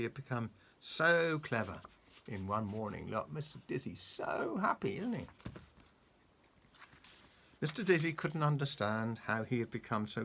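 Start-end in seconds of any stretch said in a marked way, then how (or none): none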